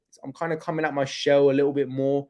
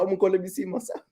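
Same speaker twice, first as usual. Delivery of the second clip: excited mum; 5 semitones higher, 3 dB quieter, faster than usual. Retold or imitated. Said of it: "So she's like".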